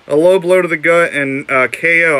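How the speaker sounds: big, punchy announcer voice; dramatic announcer read. Acted. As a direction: land the knockout like a ring announcer. Reads label like announcer.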